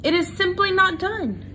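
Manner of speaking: high prim disapproving aunt; a fussy old aunt's sniff; Aunt Florence. A narrator's reading. Aunt Florence scolds Despero's act, high prim disapproval. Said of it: "distinctive voice of Despero's Aunt Florence".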